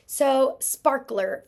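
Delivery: "sparkler" drawn out loud and slow. Shown as a demonstration. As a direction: say "sparkler" slowly, stretching it out. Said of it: "chop out or segment my syllables".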